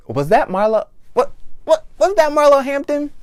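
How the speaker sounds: did I just see her?; sudden high-pitched disbelief; surprised double take. Retold or imitated. Double take spotting Marlo.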